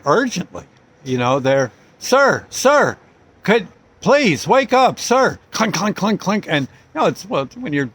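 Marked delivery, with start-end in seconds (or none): 2.0-5.4 higher, louder urgent police call; urgent cop. Retold or imitated. Cop knocking on a car window, calling out urgently. 5.5-6.5 higher mock clinking knock noises; knocking imitation. Teller imitates cops rapping on the glass, mock clinks.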